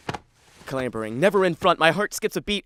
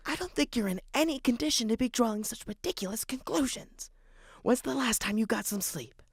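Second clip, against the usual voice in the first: hushed reproach; 4 semitones higher, 6 dB quieter than usual. Acted.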